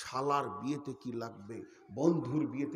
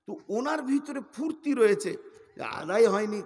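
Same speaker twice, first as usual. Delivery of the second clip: loud exclaiming; 7 semitones higher, 11 dB louder than usual. Real, not acted. Preacher exclaims, loud and high.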